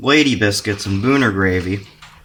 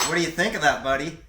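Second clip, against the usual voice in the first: put-on baby talk; 6 semitones higher than usual. Baby-talking his friend while feeding.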